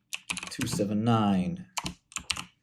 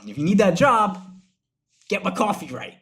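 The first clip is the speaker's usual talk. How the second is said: higher, louder hammed-up complaint; mock outrage; 9 semitones higher, 5 dB louder than usual. Customer complaining to shop owner about a worker, mock outrage. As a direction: play up the indignant customer.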